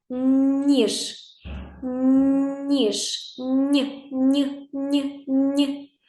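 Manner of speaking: long palatal "Н" opening "Ніж", then short "Ні" repeated; soft consonant. Shown as a demonstration. Teacher showing a softly pronounced sound, palatal "Н" in "Ніж". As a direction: stretch the first sound of "Ніж", then isolate it as "Ні". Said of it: "Звук вимовляється м'якенько".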